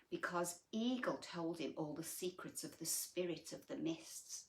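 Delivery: ordinary delivery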